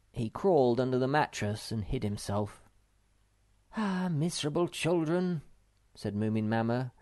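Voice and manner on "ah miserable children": high, sighing mother voice; weary sigh. A narrator's reading. Mother sinks down tired of the children, sighing -. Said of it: "suddenly feeling rather tired".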